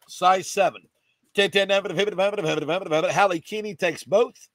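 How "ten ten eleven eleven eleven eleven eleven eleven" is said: quick rhythmic bid-calling chant; auction cadence. Real, not acted. Auctioneer taking bids for the shoes, quick chant. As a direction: roll the numbers like an auctioneer. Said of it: "in the middle of the cadence".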